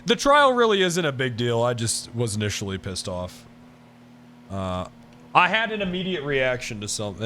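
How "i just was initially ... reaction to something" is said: usual speaking voice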